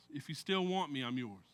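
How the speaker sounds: soft, low surrender to Jesus; hushed prayer. Retold or imitated. Pastor retelling Steve's prayer in his truck, hushed and soft. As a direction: breathe it out like a quiet prayer. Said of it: "he tells Jesus".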